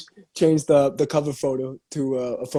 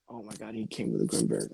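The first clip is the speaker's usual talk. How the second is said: ordinary talk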